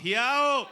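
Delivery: a loud, high "Yo!" as a horn blast; imitated car honk. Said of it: "I give him, like, a man honk".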